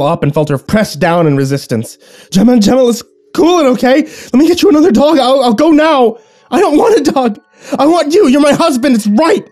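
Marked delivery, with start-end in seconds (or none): 2.3-6.2 very high, loud panicked pleading; buy time in terror; husband pleading with his raging wife; frantic bargaining. Acted. 6.5-9.5 very high, loud shriek; possessed wife screaming at her husband; shrieking refusal. Acted.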